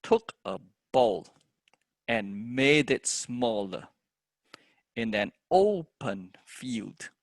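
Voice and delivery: slow, measured verse with the content words drawn out; stressed poem reading. Shown as a demonstration. Teacher reading the whole poem with stresses marked, slow recital. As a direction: recite slowly, leaning on each stressed word. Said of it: "the whole thing will be".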